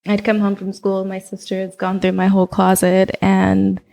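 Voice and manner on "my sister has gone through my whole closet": normal talking voice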